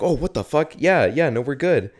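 startled reply. Retold or imitated. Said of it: "they're like".

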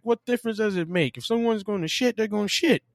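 high-pitched retold exasperation; exasperated argument.

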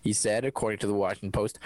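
ordinary delivery

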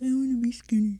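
lightly mopey, pitiful whine; sad fat girl voice. Retold or imitated. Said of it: "That's my fat girl, sad, sad voice".

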